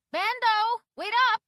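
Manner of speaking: high, loud call from a distance. A narrator's reading. Grandma calling after the dog.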